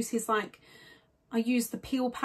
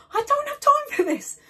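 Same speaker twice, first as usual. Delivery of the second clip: exasperated; 6 dB louder than usual. Real, not acted. Door knock interrupts rushed filming.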